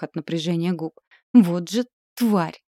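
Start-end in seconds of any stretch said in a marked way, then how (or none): 1.3-2.6 horrified. Acted. Heroine sees monster.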